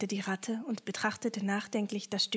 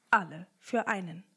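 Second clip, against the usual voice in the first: plain reading voice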